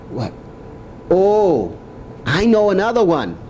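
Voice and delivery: usual speaking voice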